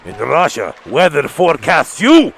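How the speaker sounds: put-on heavy Russian accent menace. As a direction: Yakov Smirnoff style Russian joke voice. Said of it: "that's my Russian".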